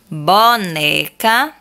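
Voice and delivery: slow, syllable-by-syllable "Boneca"; slow word. Shown as a demonstration. Teacher reading "Boneca" slowly to the class. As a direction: spell out "Boneca" by its beats. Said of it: "falar pausadamente".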